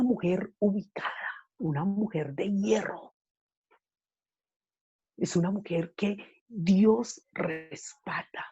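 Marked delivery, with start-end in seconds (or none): 1.6-8.5 soft whispered emphasis; slow whispered words about a woman of iron. Real, not acted. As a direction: drop to a whisper to drive it home. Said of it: "whispered emphasis in sermon".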